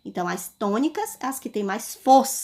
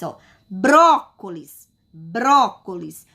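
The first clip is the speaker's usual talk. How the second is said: brócolis slowly, leaning on BRÓ; stress on BRÓ; 2 semitones lower, 4 dB louder, slower than usual. Shown as a demonstration. Teacher asking pupils for the strong syllable, BRÓ stressed in brócolis. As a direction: stretch the first syllable of brócolis. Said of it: "ao pronunciar a palavra brócolis".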